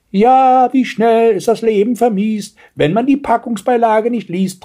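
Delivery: high, loud gloating grandmother; triumphant. A narrator's reading. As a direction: crow it in triumph. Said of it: "triumphierte die Großmutter". (triumphierende Großmutter, hoch)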